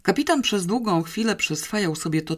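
usual speaking voice

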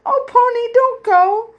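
high, loud plea to stay; pleading cry. A narrator's reading. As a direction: call out urgently, very high. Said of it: "cried Edith".

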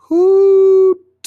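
high, loud shepherd's call; last strength into the call; exhausted boy calling his flock; reassuring call. Acted. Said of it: "one reassuring".

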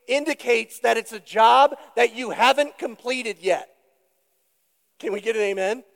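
ordinary delivery

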